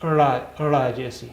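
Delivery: ड़ा said with a flapped retroflex r; sound demo. Shown as a demonstration. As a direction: say ड़ा as a teacher's sound model. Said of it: "और इसकी आवाज़ है".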